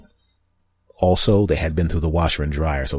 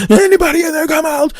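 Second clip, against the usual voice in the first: much higher, louder barked command; TV cop; 10 dB louder than usual. Retold or imitated. Clearing the house like a TV cop, high loud bark. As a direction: bark it like a TV detective. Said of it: "like I'm in Law and Order S V U".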